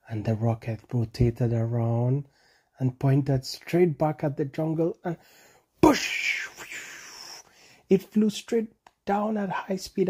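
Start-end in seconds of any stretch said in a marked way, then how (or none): 5.8-6.5 whooshing push. A narrator's reading. Sound effect of launch.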